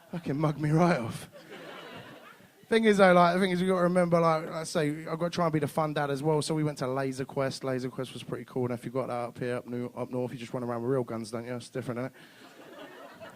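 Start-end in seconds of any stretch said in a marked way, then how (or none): none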